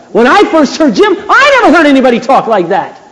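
high, loud sermon delivery; full-force preaching. Real, not acted. Preacher insisting Jesus was a man of power, full-force preaching. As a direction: proclaim it forcefully, pitched well up.

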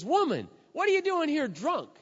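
high, loud accusing priest voice; scolding priest. Retold or imitated. Priest Eli accusing Hannah, loud and high. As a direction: hurl it like a scandalised old priest. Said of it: "And he walks over and says".

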